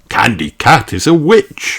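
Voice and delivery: plain reading voice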